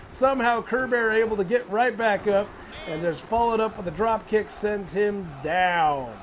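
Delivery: ordinary talk, nothing marked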